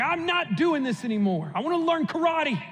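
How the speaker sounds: put-on a kid done with chores, pitch climbing; fed up. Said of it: "Daniel gets fed up with it".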